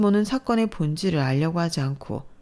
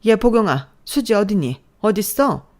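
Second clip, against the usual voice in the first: loud, higher calling out for her daughter; frantic calling; 5 semitones higher, 8 dB louder than usual. Acted. Mother-in-law storming in, calling loudly. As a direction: shout through the house, searching.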